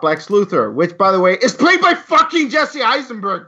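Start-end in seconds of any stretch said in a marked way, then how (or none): 1.4-3.5 angry outburst. Real, not acted. Ranting about Lex Luthor casting.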